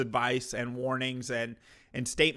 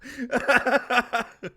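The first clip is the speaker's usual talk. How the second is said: loud, high disbelieving laugh; real laugh, loud and high; burst of laughter; 10 semitones higher, 8 dB louder than usual. Real, not acted. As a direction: laugh out in shock at what you just saw. Laughing at shocking clip.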